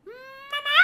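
loud, high call for mom. Acted. Kid shouting for his mama from the game. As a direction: shout across the house. Calling mom from another room.

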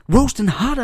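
loud, high hype yell; hyped shout. Acted. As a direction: shout it like a wrestler promo. Hyped sign-off bit.

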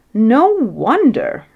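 loud, drawn-out retort. A narrator's reading. King answering his tearful queen, loud scornful retort. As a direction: throw it back, high and slow. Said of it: "retorted the king".

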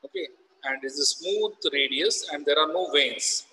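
normal talking voice

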